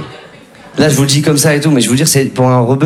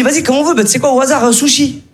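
friend deciding; 7 semitones higher than usual. Retold or imitated. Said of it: "il me dit".

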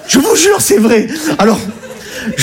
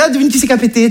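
high-pitched joking voice; playful, raised-pitch teasing; silly prank call; 7 semitones higher, faster than usual. Acted. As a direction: act out a daft phone prank. Imitates his own prank calls.

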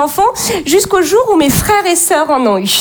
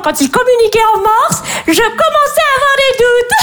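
rising, higher-pitched show-off mother; boasting competitive mum; 5 semitones higher than usual. Acted. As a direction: brag about your gifted baby, pitch climbing. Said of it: "les mamans compètes".

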